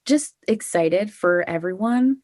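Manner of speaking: normal talking voice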